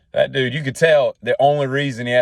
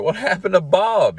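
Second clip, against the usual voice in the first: pitched-up, surprised ask; curious and a bit alarmed; surprised question; 11 semitones higher, 4 dB louder than usual. Retold or imitated. Friend at the barber shop asking, surprised.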